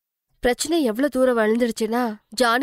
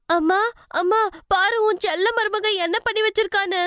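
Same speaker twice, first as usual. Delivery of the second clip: much higher calling out, mock alarm; sister-in-law yelling to her mother about Alka; shouting for mother; 10 semitones higher than usual. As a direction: cry out for mum in high fake outrage. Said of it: "சத்தமா கத்த ஆரம்பிச்சா".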